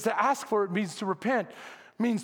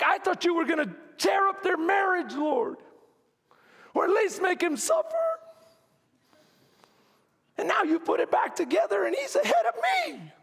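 jealous shout; 9 semitones higher than usual. Retold or imitated. Jealous believer complaining to God.

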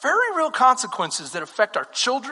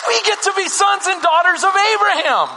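impassioned scripture preaching, climbing higher; building preaching; 6 semitones higher than usual. Real, not acted. Preacher telling the congregation they are Abraham's children, building fervour. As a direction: build the verse toward a high, ringing declaration.

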